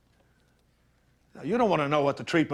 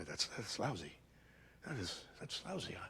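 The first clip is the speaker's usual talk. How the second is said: muttered sympathy; 5 semitones lower, 21 dB quieter than usual. Acted.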